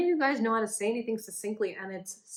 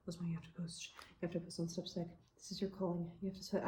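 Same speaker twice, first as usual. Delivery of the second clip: soft, low inner voice urging; inner urge voice; 3 semitones lower, 10 dB quieter than usual. Retold or imitated. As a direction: coax yourself quietly, almost a whisper. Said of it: "urge to be like".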